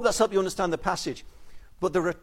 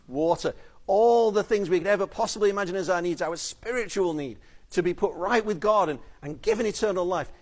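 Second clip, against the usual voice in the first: ordinary talk, nothing marked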